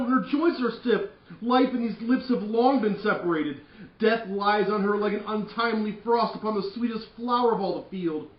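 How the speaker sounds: high, loud melodramatic grief; hammed-up lament. Parents lamenting dead Juliet, high and loud. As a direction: wail over the cold body. Lady then Lord Capulet lament.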